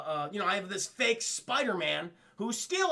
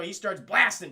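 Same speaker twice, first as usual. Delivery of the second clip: normal talking voice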